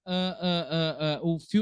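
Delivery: ordinary delivery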